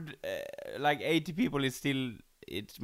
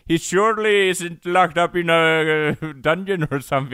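pitched up, smug and loud; 3 semitones higher, 6 dB louder than usual. Retold or imitated. Voicing the king.

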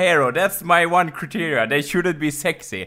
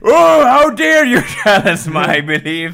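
mock offended; 4 semitones higher, 6 dB louder than usual. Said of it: "He can".

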